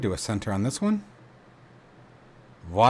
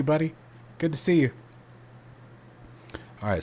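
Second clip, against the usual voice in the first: normal talking voice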